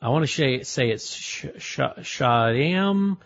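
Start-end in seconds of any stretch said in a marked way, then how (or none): 1.6-3.2 stumbling on "Shadam", stretched out slowly; unsure pronunciation. Real, not acted. Host attempts tomorrow's guest's name, hesitant "Shadam". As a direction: feel out "Shadam" with halting false starts. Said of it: "you might have to help me with his name".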